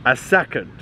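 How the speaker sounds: ordinary delivery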